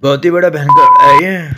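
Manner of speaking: loud, laughing exclamation; laughing shout. Acted. (हँसते हुए ज़ोर से चिल्लाना)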